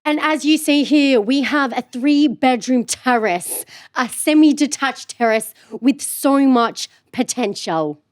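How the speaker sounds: put-on slow, posh English accent, touring a house for sale; mock TV property host in a posh English accent; posh English estate agent. Sister asked for her best English accent, slow posh English property host. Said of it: "Escape to the Country vibes".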